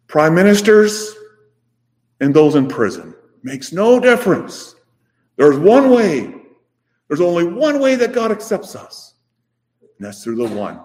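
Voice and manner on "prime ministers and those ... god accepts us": higher, louder, building preacher's cry; rising preaching. Acted. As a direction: lift the congregation with each word.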